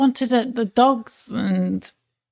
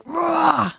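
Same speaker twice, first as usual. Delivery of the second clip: high, drawn-out mock roar; 6 semitones higher than usual. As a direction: let out a pent-up "rah" without much volume. Mimes letting pent-up feelings out.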